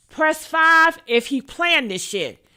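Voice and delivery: ordinary talk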